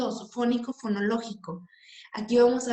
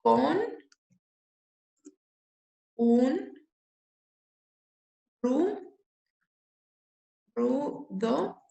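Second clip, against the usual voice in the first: "con un rudo y torvo" in isolated syllables with long pauses; 2 semitones higher, much slower than usual. Shown as a demonstration. Teacher dividing a verse into syllables, syllable by syllable. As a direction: dictate "con un rudo y torvo" piece by piece, pitch lifting. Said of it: "Vamos a dividir esta".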